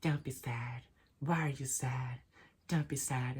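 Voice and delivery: lightly voiced soft, slowed pity from a passerby; mock sympathy. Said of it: "And then people say".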